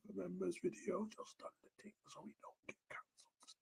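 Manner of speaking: soft unvoiced whisper; conspiratorial whisper. Real, not acted. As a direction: hide it from the chat. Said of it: "I'm whispering on live stream".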